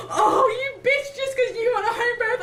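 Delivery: very high, loud, half sobbing half laughing; laugh-crying whine. Retold or imitated. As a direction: wail it through tears and laughter. Mock insult at midwife.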